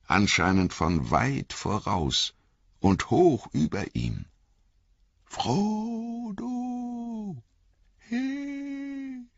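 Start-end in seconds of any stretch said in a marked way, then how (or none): 5.2-9.3 thin faint voices from far; high, soft call through fog; muffled drifting fog spirit. Acted. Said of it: "drangen dünne Stimmen durch den Nebel".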